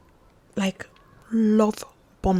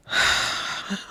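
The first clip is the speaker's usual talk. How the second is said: soft, wordless exhale; sigh; 6 dB quieter than usual. Real, not acted. Telling of the Wednesday he went silent, soft sigh.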